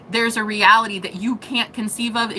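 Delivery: ordinary delivery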